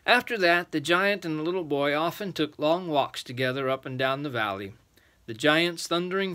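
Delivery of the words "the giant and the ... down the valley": ordinary delivery, nothing marked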